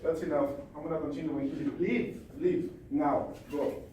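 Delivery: ordinary talk, nothing marked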